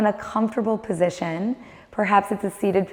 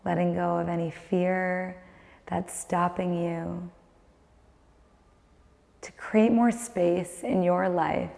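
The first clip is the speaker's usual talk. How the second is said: guided meditation; 6 dB quieter than usual. Acted. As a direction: guide the listener inward, calm and unhurried.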